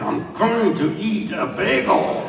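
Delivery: each word of "I'm going to eat a bagel" drawn out slowly; slow and deliberate. Shown as a demonstration. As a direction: spell out every word with grim patience.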